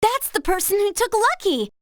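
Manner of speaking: high-pitched burst of discovery; excited realization. Acted. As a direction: blurt it out as the mystery clicks. Recognizes the culprit among suspects.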